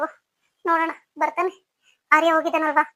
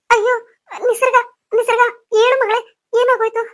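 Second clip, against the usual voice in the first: high, loud, sobbing cries of her name; panicked crying out; 6 semitones higher, 5 dB louder than usual. Acted. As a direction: call to the fallen girl in sheer alarm. Nisarga collapses, elder panics. (ಗಾಬರಿಯಿಂದ ಅಳುತ್ತಾ ಕೂಗು)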